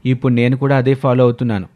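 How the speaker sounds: ordinary delivery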